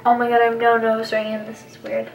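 ordinary talk

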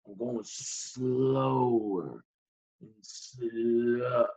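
very slow, low and soft, stretched-out words; grinding to a halt. Acted. Patient acting out his body giving out, grinding to a halt.

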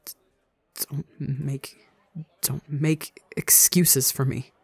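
choking back sobs, voice catching; choked out. Acted. Ranbu choking on tears as he pleads with Tubbo. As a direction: fight through a tight, tearful throat. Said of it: "Ranbu chokes out".

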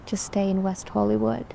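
normal talking voice

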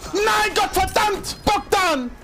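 slow high furious shout; panicking gamer. Real, not acted. Gamer gets shot.

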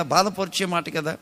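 normal talking voice

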